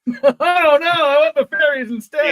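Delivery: high, loud comic wail; hammed-up shouted objection; mock protest. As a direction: cry it out in pretend dismay. Mock protest answering the Satan joke.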